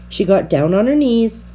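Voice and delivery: plain reading voice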